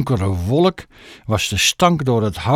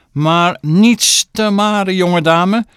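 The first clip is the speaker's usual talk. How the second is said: stern scolding; 7 semitones higher, 5 dB louder, slower than usual. Acted. Mother scolding daughter.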